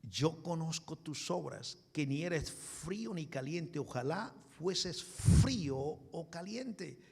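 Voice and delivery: slow, deliberate reading with drawn-out key words; measured scripture reading. Acted. Preacher reading the Lord's message to Laodicea, slow and measured. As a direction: read the verse out slowly and weightily.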